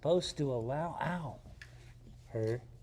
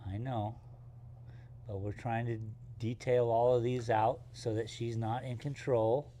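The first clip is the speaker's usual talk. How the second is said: ordinary talk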